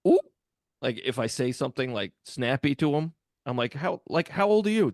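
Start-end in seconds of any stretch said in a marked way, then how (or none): 0.1-0.2 mocking ooh. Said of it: "they do that thing". 3.7-4.9 high incredulous demand. Retold or imitated. Confronting kids, high and loud.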